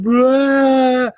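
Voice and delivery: a loud, high burst of a sob; sobbing wail. Retold or imitated. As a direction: break into a child's sobbing cry. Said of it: "I'm absolutely sobbing like a broken-hearted child".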